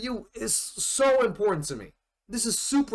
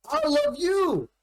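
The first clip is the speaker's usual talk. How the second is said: emotional confession; 12 semitones higher, slower than usual. Retold or imitated. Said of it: "he goes like".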